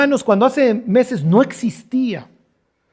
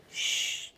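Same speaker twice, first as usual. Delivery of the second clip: un shh sin voz para callar; chistido; 17 dB quieter than usual. Acted. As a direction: chista para que no lo oigan. Adán escondido chista. (shh susurrado)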